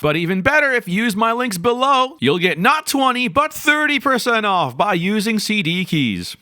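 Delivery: upbeat sales pitch; infomercial voice. Pretend. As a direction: pitch it like a TV ad host. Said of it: "now I sound like an infomercial".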